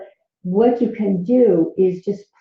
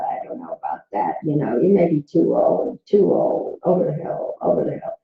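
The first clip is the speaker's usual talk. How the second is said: sly whispered taunt, half-sobbing; whispered inner critic; 4 semitones lower than usual. Retold or imitated. Inner critic whispering doubts at the speaker, sly and mocking. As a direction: hiss the doubts like a nagging inner voice. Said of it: "the monkey mind says".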